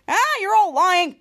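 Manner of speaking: loud accusing voice; loud accusation. Retold or imitated. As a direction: accuse them all loudly. Ray accusing the team.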